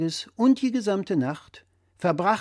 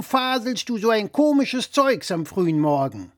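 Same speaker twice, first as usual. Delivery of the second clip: louder, higher know-it-all dad voice; blustering father; 6 semitones higher, 5 dB louder than usual. Acted. Father lecturing his son over the phone, louder and higher. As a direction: play the pompous father correcting his son.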